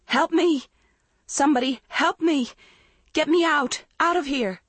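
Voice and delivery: high loud terrified screams; screaming for help. Acted. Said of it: "She screamed".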